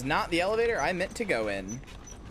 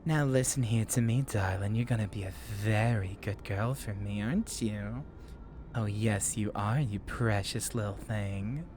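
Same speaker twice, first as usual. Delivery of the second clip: southern mommy voice; 9 semitones lower, 4 dB quieter than usual. Shown as a demonstration. Viewer asked to combine them, low southern mommy voice. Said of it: "combining the mommy voice and the southern accent".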